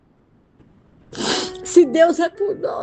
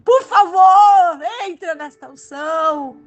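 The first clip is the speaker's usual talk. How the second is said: full-force preaching; 6 semitones higher than usual. Real, not acted. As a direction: preach it with fire. Predicación a plena voz.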